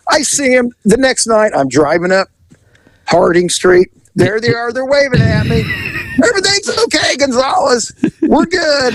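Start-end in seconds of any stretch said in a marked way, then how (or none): 6.2-8.9 high, singsong mock reassurance; cheery calling out. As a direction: imitate a reconciled couple hailing the officer. Retold couple calling out to officer.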